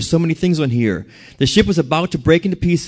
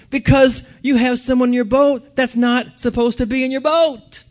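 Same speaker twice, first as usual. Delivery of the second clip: rising preaching climax; 7 semitones higher than usual. Acted. Preacher driving home the boat point, rising pitch. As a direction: voice climbs higher to a peak on boat.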